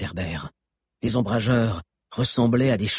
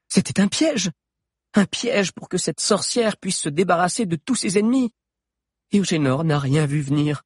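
high-pitched, heated explanation; agitated explaining; 8 semitones higher than usual. Acted. Alix explaining to Valère that it was a trap, agitated. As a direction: spell out the trap with indignation.

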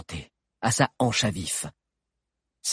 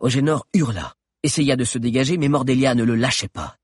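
plain reading voice

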